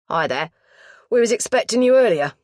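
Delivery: put-on loud London-accented greeting; strong London accent. A narrator's reading. Mortuary lad greets police visitors, strong London accent. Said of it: "he said in a strong London accent".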